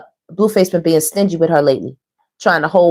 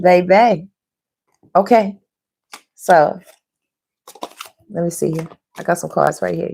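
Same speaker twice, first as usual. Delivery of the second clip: ordinary delivery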